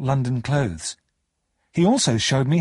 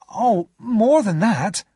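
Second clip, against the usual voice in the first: high, louder exclamation; drawn-out, high protest; exclaiming; 9 semitones higher, 5 dB louder, much slower than usual. A narrator's reading. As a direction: exclaim it, pitched well up. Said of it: "I exclaimed".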